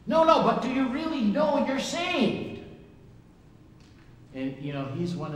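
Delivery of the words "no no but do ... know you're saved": high, loud mock evangelist; hammed-up pushy believer pressing hard. Preacher mimics street evangelist pressing him, hammed-up high and loud.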